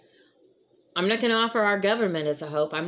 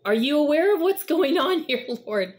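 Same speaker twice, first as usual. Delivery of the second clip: higher, louder, half-laughing mock complaint; exasperated plea to God; 9 semitones higher, 4 dB louder than usual. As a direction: ask God with a chuckle of disbelief. Believers questioning God in trouble.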